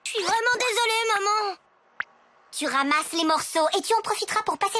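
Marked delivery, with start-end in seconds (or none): 0.0-1.6 high, rushed excuse to mom; panicked pleading. Acted. Boy caught by mom, high panicked pleading excuse. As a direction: beg mom not to blame you.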